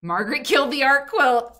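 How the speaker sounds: loud, high proclamation with KILLED punched; dramatic announcement. Pretend. Host declares Margaret the culprit, loud and dramatic. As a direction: boom out "Margaret killed the art quilt." like a headline. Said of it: "Oh, that's so good".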